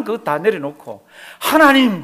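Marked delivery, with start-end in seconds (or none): 1.4-2.0 loud, high cry of "God". Acted. Believer who laid everything down, crying out to God. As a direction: call out to God with all your force. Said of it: "그러고 와서 하나님을 붙들고".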